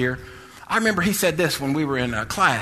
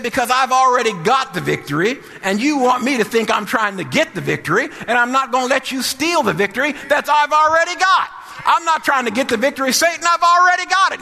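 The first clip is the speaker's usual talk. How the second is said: building defiance; 9 semitones higher, 5 dB louder than usual. Retold or imitated. Hagin defying devil, building shout.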